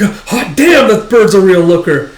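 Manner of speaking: high, loud acted awe; hammed-up lovestruck exclamation; smitten excitement. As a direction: gush like a smitten hoodlum. Character smitten at first sight.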